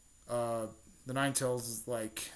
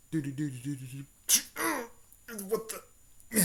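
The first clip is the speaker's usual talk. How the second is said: mock dramatic da-da-da, rising into a startled "what the"; hummed suspense sound; 3 semitones higher than usual. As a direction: hum a cartoon suspense cue. Imitating music before a sudden attack.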